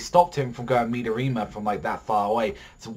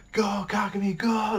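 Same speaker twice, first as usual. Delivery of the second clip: high, urgent call to run; cheering him on; 10 semitones higher than usual. Retold or imitated. Fan voicing a teammate urging Kagami to run, high call. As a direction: yell him down the court, much higher.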